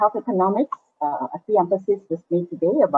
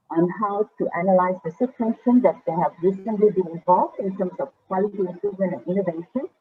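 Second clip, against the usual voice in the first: ordinary delivery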